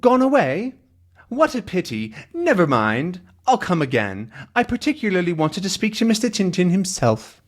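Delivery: high-pitched puzzled caller; hard-of-hearing visitor. Acted. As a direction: chatter high, having misheard. Voices deaf visitor mishearing.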